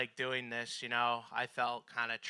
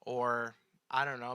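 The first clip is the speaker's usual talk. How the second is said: ordinary delivery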